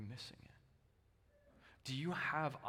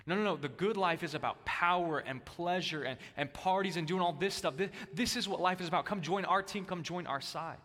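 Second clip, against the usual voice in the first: louder, higher partying recruiter pitch; party crowd invite; 4 semitones higher, 6 dB louder than usual. Retold or imitated. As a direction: sell the good life like a party host. Herod's crew, inviting to parties.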